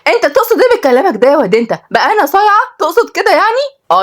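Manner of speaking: high, indignant squawk; soaring offended outrage; exasperated. A narrator's reading. Sister rounds on her cheeky brother, exasperated. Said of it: "وهي قالت بغيظ".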